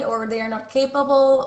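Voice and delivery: normal talking voice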